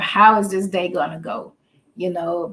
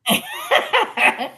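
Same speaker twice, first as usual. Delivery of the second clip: caught laughing. Real, not acted. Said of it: "Did you just bust time like that for real?".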